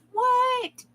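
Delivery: sudden high squeak of surprise; startled what. Real, not acted. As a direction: caught off guard, jump way up. Stream starts without warning.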